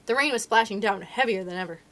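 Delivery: plain reading voice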